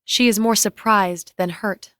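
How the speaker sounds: plain reading voice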